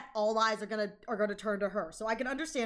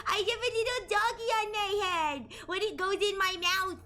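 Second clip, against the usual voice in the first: high, silly possessed voice; parasite voice; 8 semitones higher than usual. Pretend. Streamer greeting Blood as the parasite, high and goofy. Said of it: "I get to talk like this because it takes over".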